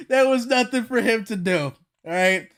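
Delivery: usual speaking voice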